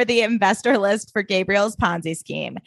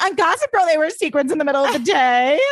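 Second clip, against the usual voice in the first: put-on indignant teen protest; 8 semitones higher than usual. Teen self retold, defending outfit.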